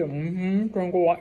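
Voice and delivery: talking through a spoonful; mumbled around a mouthful; mouth full. Retold or imitated. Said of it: "right when you put the spoonful in your mouth".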